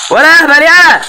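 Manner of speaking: high loud yell at enemy. Real, not acted. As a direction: shout it out in panic. Yelling at enemy in game.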